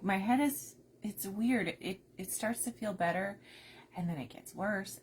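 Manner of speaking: normal talking voice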